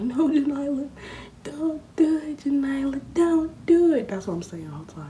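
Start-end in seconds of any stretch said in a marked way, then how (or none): 0.0-4.1 very high, loud pleading; desperate plea. Retold or imitated. Begging Janila not to hit the bag, high and loud. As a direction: beg her to stop, frantic.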